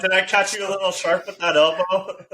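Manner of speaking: put-on Canadian accent. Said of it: "Not even gonna try the accent".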